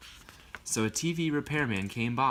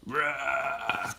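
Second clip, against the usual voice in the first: playful roar; 3 semitones higher than usual. Acted. Father's angry roar in story.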